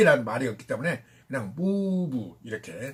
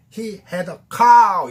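loud; 3 semitones higher, 9 dB louder than usual. Shown as a demonstration. Teacher showing the class to say it loudly. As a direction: say it big and loud. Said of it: "이렇게 크게 말해".

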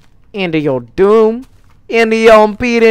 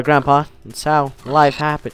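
ordinary delivery

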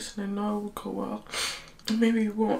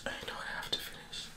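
real teary whisper; 11 dB quieter than usual. Real, not acted. Filming herself crying before dawn, teary whisper. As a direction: keep it a secret whisper, barely voiced. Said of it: "I don't know why I'm whispering".

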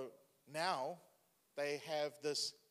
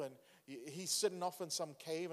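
usual speaking voice

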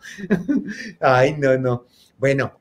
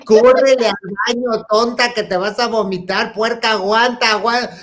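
higher, louder slurring orders; drunken yell; 6 semitones higher, 4 dB louder than usual. Retold or imitated. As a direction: bark it drunkenly toward the bathroom. Drunk friend yelling while sick.

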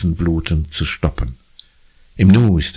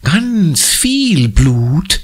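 very slow, dark and menacing; ominous murmur; 12 semitones higher, 4 dB louder, much slower than usual. A narrator's reading. Conny spooking Jakob, slow ominous seer. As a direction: drag it out to scare him. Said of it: "raunt sie gefährlich".

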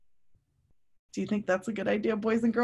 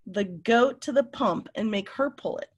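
ordinary delivery

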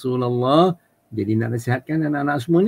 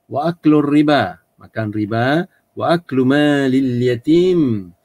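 recitation; 3 semitones higher, 4 dB louder, slower than usual. Shown as a demonstration. Arabic hadith recitation.

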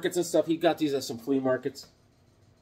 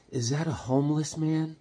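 put-on child voice; 4 dB quieter than usual. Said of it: "And the kid goes".

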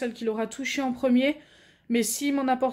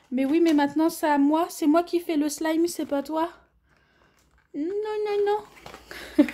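playful scolding; 4 semitones higher than usual. Real, not acted. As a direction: tease the dog, no no no.

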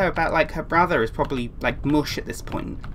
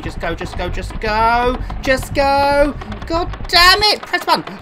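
builds from usual pitch to high, loud shouting; escalating panic; 6 semitones higher, 5 dB louder, slower than usual. Real, not acted. Gamer desperate to get away, urging rising to a shout. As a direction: urge it on, louder each time.

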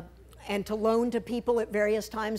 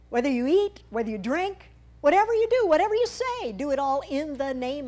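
high, louder preacher's cadence; fervent preaching; 5 semitones higher, 4 dB louder than usual. Acted. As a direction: proclaim each clause to the congregation. Preaching the verse at full force.

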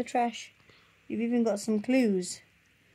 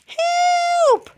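very high, loud squawk for help; cry for help; 6 dB louder than usual. Acted. Trapped birds shriek for help at the fox. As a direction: scream it like a panicked bird. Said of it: "they all cried".

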